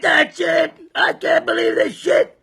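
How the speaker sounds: a retold farmer's raging yell; berserk farmer. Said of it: "He went berserk".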